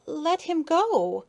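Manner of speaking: high, slow, pleading cry; drawn-out, high-pitched plea; tearful cry. A narrator's reading. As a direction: plead for a friend's release, close to tears. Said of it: "cried Maya".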